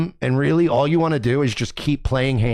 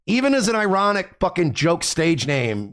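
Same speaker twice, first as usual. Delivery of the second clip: ordinary delivery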